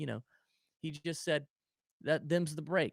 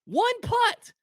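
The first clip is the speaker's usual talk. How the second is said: rising higher and louder in disbelief; incredulous rant; 7 semitones higher, 6 dB louder than usual. Real, not acted. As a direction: build into an outraged, laughing rant. Ranting about the lucky putting.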